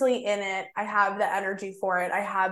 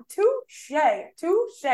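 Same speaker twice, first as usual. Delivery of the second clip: mock touche; 9 semitones higher than usual. Mocking reply to the guy.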